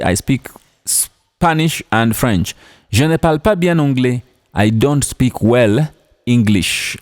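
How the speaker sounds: ordinary talk, nothing marked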